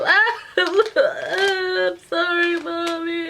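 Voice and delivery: high, very slow fake-sobbing child; mock kid crying.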